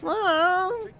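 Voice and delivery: put-on high kid calling mom; whiny child voice. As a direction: whine it like a needy child. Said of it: "the annoying child voice".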